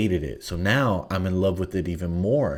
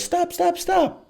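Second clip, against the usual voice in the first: much higher falsetto stop, stop, stop; mock shrill woman; slower than usual. Mocking the woman's repeated stop in falsetto. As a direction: squeal it in a high falsetto like her. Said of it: "she goes".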